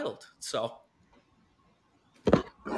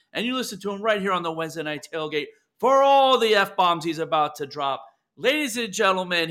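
announcer voice; 5 semitones higher, 6 dB louder than usual. Acted. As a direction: hype up the show open like a radio announcer. Host introduces second guest.

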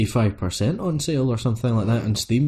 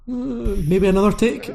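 much higher, tactful mock suggestion; polite studio hint; 11 semitones higher than usual. Mimicking the lads hinting at another take. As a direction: gently suggest a retake. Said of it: "give you the".

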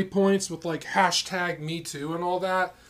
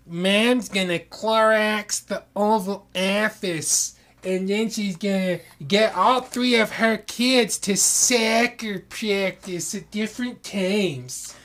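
suburban mom voice; 5 semitones higher, 5 dB louder, slower than usual. Acted. Mocking suburban mom candidate.

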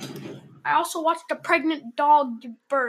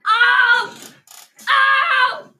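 high, loud ow shouts; pained yelp; 9 semitones higher, 8 dB louder than usual. Real, not acted. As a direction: yelp in pain. After being slapped.

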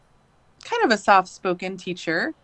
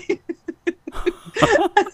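real laugh, much higher; 11 semitones higher, 4 dB louder than usual. Real, not acted. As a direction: burst out laughing at the memory. Laughing at teacher's reveal.

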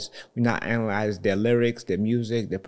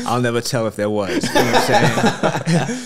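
laughing; 6 semitones higher, 6 dB louder than usual. Real, not acted. Laughing at vault question.

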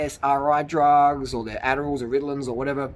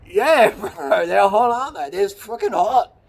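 high, loud, laughing bro reply; laughing mock agreement; 7 semitones higher, 7 dB louder, slower than usual. Replying to insults about his girlfriends, laughing and high. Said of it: "I'm like".

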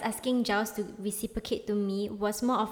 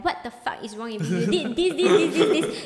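loud, mock-furious tirade building in pitch; full-out rant; 4 semitones higher, 6 dB louder than usual. Friend re-enacts texting him when upset with him, mock-angry rant. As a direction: act out an upset rant, half laughing. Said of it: "I go full out".

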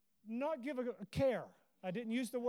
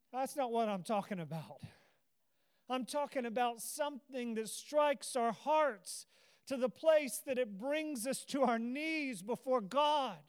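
ordinary talk, nothing marked

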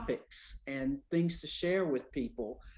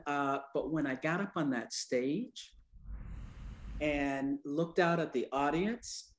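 ordinary talk

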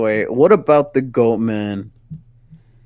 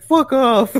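laughing retort; 12 semitones higher, 3 dB louder than usual. Retold or imitated. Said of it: "she was like".

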